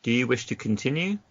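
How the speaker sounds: ordinary talk, nothing marked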